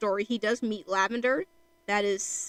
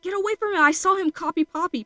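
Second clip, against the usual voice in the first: high, loud mock alarm; alarmed warning; 8 semitones higher, 6 dB louder than usual. Thistle warning Lavender away from Fatal, alarmed. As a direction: call out an urgent warning, pitched up. Acted warning that ruins a friendship.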